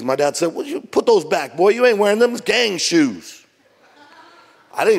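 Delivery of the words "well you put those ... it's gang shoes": dad's mock outrage, pitched up; retold scolding. Dad refusing his son's shoe choice, retold scolding. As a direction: imitate a father laying down the law, drawing out the ending.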